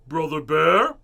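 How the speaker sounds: loud, firm father calling his son; stern Papa voice. A narrator's reading. Papa scolds Brother for shouting at his sister, loud and stern. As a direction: boom it out like a scolding dad. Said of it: "said Papa in a Papa voice".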